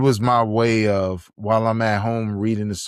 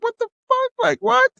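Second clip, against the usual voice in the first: stunned disbelief. Retold or imitated. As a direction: squeal of shock. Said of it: "I was like".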